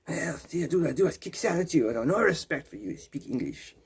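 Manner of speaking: lightly put-on French accent, trailing off quiet; mock French accent. Mock French local scolding a tourist in French accent. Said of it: "the French accent volume up".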